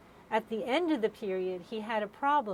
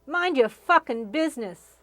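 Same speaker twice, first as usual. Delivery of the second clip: loud yell, a bit higher; 4 semitones higher, 9 dB louder than usual. Retold or imitated. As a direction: yell it across the room. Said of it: "she yells out".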